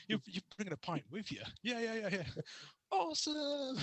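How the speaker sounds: high, quick, delighted mock chatter; hammed-up excited banter. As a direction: play two giddy runners egging each other on. Mock runners' banter at OCR.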